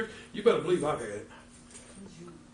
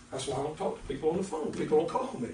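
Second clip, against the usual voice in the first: normal talking voice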